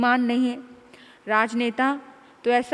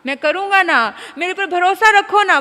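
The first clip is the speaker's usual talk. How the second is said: mocking promise; 7 semitones higher, 10 dB louder than usual.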